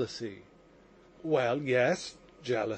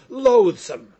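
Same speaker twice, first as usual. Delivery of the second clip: second loathsome much louder, peak of disgust; 4 semitones higher, 9 dB louder than usual. A narrator's reading. Mitya describing his father, loud revulsion. Repeated word, building disgust.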